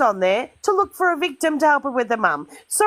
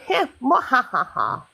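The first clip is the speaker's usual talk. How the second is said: slow villainous mwahaha; evil doctor laugh; slower than usual. Acted. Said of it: "That was the evil doctor, whatever laugh".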